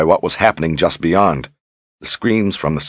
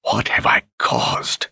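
slow, horrified whisper; 4 dB quieter, slower than usual. Acted. Richard in shock at what he has caused, whispering. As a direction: breathe it out slowly, voiceless. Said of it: "he whispered".

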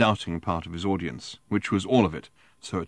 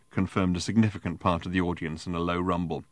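usual speaking voice

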